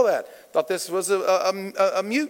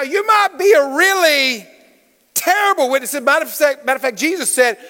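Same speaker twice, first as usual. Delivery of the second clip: ordinary talk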